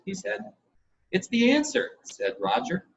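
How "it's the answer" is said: put-on excited boy. Roger bursts in with news.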